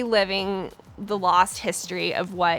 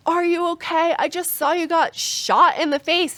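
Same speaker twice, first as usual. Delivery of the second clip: imitating a worried guy, much higher voice; mock a bystander rushing over, pitched way up; concerned stranger; 8 semitones higher, 3 dB louder than usual. Bystander rushing over to ask if she's okay, imitated high. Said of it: "runs over in the parking lot being like".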